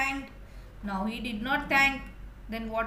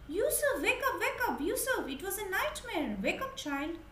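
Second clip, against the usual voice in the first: high, gentle call to wake up; parents waking child; 10 semitones higher than usual. Acted. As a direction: coax him out of a bad dream. Parents waking him from nightmare.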